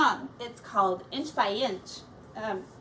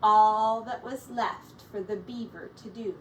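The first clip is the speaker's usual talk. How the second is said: story narration. A narrator's reading. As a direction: read it like a picture book for kids.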